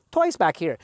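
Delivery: ordinary delivery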